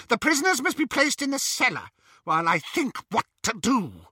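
high-pitched, imperious order; agitated command. A narrator's reading. Bellatrix ordering her sister about prisoners, high agitated command. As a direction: give the order up high, tense.

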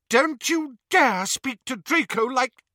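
high, loud indignation; furious. A narrator's reading. Narcissa defending Draco from her sister, furious. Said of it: "said Narcissa furiously".

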